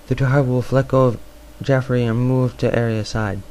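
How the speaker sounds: plain reading voice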